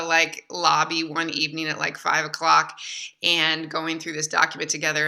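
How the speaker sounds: ordinary delivery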